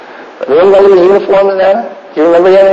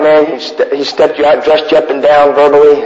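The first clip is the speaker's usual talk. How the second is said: ordinary talk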